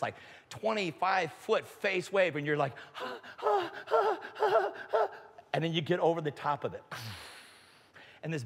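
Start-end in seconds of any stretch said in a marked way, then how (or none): 3.0-5.1 high, frantic gasps; panicked gasping. Retold or imitated. Surfer facing a monster wave, panicked gasping. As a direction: gasp as if scrambling up a huge wave.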